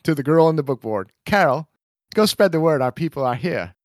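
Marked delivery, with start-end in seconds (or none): 1.2-3.7 lightly hammed-up homesteader; a hint of a rural settler; dramatic reading. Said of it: "dramatic reading".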